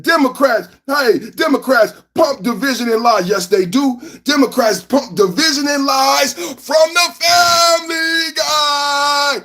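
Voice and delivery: shouting and half-singing a donor's name; hype shout-out. Acted. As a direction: belt it out like a hype man over the music. Reading super chat, hype voice.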